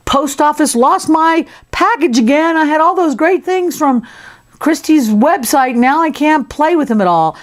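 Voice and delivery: high-pitched, pouty complaining; put-on grumpy whinge, pitched well up; cranky. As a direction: whine like a mock-cranky little character. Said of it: "and now he's cranky".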